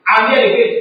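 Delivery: loud mock shout at the altar; retold shouting prayer. Man at the altar shouting for money, loud mock yell. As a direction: bellow a petty prayer at the altar. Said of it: "shouting at the top of his voice".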